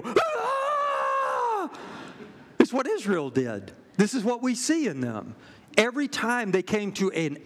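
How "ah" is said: short high piercing shriek; hammed-up alarm scream; kids' panic scream. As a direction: shriek like a room of panicked kids. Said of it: "we go".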